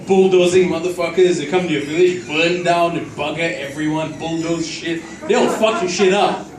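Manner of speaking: ordinary talk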